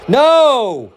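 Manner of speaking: sharp high shout of protest; real shout. Real, not acted. Streamer yelling at a bad pitch call, sharp high shout. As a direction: yell no at the umpire's call.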